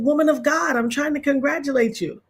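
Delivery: high-pitched playful insistence; mock exasperated congratulation. Host retells texting Kayla congratulations, playfully insistent.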